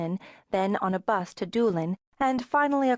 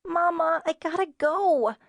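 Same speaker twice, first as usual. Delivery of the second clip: put-on high urgent little girl; whiny child; 8 semitones higher than usual. A narrator's reading. Child begging mother for the bathroom, high and urgent. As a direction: plead like a desperate six-year-old.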